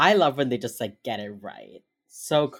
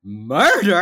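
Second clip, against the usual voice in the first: louder, startled mock question; retold disbelief, pushed out loud; shocked echo; 2 semitones higher, 8 dB louder than usual. Mom echoing the word murder at her son, shocked.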